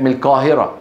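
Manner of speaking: القاهرة with ق said close to k; Egyptian k-like Qaf. Shown as a demonstration. Teacher imitating brothers from Egypt, ق as k. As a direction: soften the ق of القاهرة towards ك. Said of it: "نطقها قريبة من الكاف".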